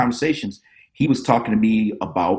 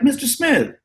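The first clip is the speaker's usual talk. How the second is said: put-on kid voice; 13 semitones higher than usual. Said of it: "I was like".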